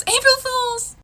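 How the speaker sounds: loud, high-pitched gotcha; gleeful prank reveal. Acted. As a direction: shout the punchline in triumph. Mom springs the prank.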